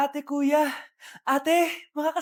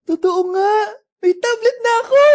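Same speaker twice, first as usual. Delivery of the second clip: loud, higher squeal of joy; loud happy cry over the tablet; overjoyed shout; 6 semitones higher, 7 dB louder than usual. Acted. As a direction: scream with delight. Child finds his promised tablet.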